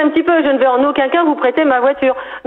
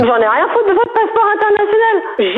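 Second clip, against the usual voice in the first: angry; 7 semitones higher than usual. Real, not acted. Driving school owner loses temper.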